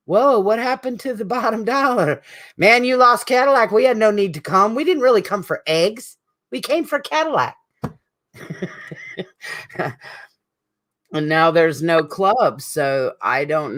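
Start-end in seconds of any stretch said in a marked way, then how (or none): 0.1-7.6 mock Waffle House regulars. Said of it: "poor Waffle House said".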